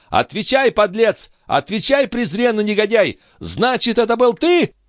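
high, furious accusation; frenzied, high-pitched tirade; mounting agitation. A narrator's reading. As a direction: demand an answer in outrage. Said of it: "со всё возраставшим возбуждением".

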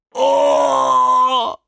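loud, high wailing groan; drawn-out anguished moan; Кирилл groaning. A narrator's reading. Cactus hearing about cactus leather, groaning. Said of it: "простонал кактус Кирилл".

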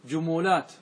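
جمولات as ju-mu-lat; three syllables in جمولات, "u" twice; damma echoed. Shown as a demonstration. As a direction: copy the "u" onto the م in جمولات. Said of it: "مثال الضمة".

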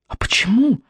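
sudden high surprised question. A narrator's reading. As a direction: ask it with real surprise. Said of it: "удивилась Софья Михайловна".

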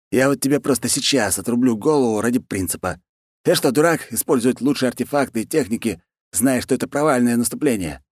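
high-pitched blustering ruler; exasperated khan. Acted. Khan lectures his underling on the plan, high blustering voice.

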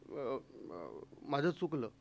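very low, hushed, hesitant admission; meek apology. Acted. Husband admits fault to angry wife, meekly and quietly. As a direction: back down softly after a scolding.

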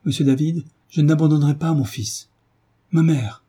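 a mother clinging to her son, crying out; anguished pleading refusal. Acted.

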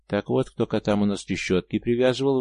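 ordinary delivery

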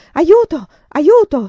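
loud, high cry for help; urlo d'aiuto. A narrator's reading. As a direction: shout for help at full force, each word separate. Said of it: "Poi urlò". (grido d'aiuto a gran voce)

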